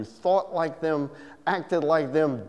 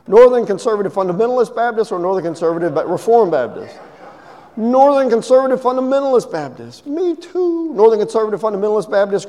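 eager; 7 semitones higher, 4 dB louder than usual. Retold or imitated. Escalating joke dialogue, rising eagerness.

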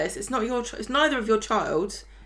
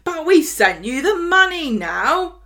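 mock magazine editor; 9 semitones higher, 8 dB louder, slower than usual. Magazine voiced, mocking.

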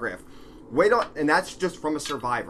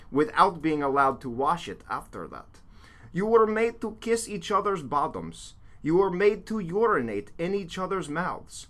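broken Russian man, deliberate mock accent; sad Russian accent. Host reading a survivor's account as asked, sad Russian accent. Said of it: "say it like a sad, broken Russian man".

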